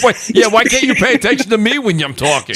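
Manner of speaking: a hint of an annoyed wife complaining; imitating wife. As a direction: play the fed-up wife, lightly hammed. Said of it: "She's probably like".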